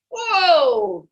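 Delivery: playful stretch exclamation; stretching whoa. Pretend. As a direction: whoa like a big yawn stretch. Big stretch in song.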